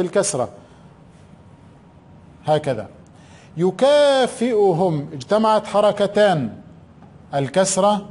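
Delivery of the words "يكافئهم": يكافئهم stretched out slowly; slow dictation. Shown as a demonstration. Teacher dictating to pupils, slow drawn-out يكافئهم. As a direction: drag out يكافئهم syllable by syllable. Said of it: "teacher dictating word slowly".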